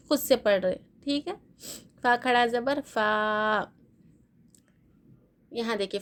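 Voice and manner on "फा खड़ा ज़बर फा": फा spelled out slowly, final vowel held long; drawn-out vowel. Shown as a demonstration. Teacher shows students the khara zabar stretch, vowel drawn out. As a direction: stretch the last फा on one long, steady "aa". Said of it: "एक अलिफ की मिकदार ही खींचा जाता".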